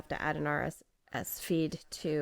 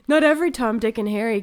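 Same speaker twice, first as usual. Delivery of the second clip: loud laughing; 5 semitones higher, 9 dB louder, faster than usual. Real, not acted. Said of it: "laughing emphatic joke".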